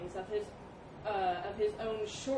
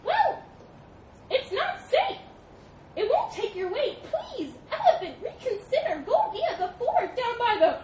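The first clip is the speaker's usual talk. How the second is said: high, loud, squeaky mouse; pleading little mouse; 14 semitones higher, 9 dB louder than usual. Acted. Mouse warning the elephant off the bridge, squeaky pleading. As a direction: squeak out an urgent plea.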